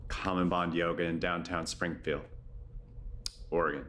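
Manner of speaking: low, measured yoga nidra voice with long pauses; guided meditation. Acted. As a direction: guide them gently into rest, low-pitched and unhurried.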